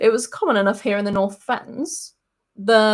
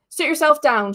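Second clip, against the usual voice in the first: brisk; 6 semitones higher, 4 dB louder than usual. Acted. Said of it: "she said briskly".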